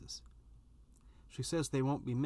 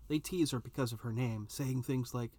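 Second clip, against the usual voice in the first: plain reading voice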